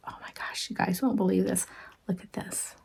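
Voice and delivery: excited breathless whisper to viewers. Real, not acted. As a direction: hush it like sharing a secret. Excited reveal of gift to viewers.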